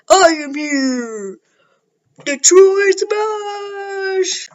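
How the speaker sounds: slow, drawn-out hero's shout, rising high on the attack name; big booming superhero call; heroic battle cry. Retold or imitated. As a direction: announce yourself like a towering hero, then yell the move. All Might arrives and attacks.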